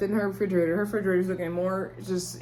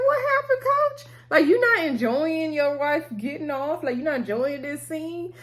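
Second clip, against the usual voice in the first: very high-pitched disbelieving questions; mock incredulous; 8 semitones higher than usual. Vlogger asking the coach why he bowed out, mock incredulous. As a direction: tease him with squeaky, astonished questions.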